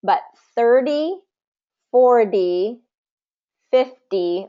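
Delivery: thirty stressed on thir, the ty light; first syllable stress. Shown as a demonstration. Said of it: "first syllable stress".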